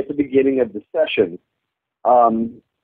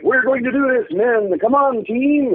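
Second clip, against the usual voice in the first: higher, emphatic leader's rally cry; rallying the troops; 11 semitones higher than usual. Retold or imitated. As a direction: pump up the team like a gung-ho leader. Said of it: "exaggerated and, and emphatic and enthusiastic he gets".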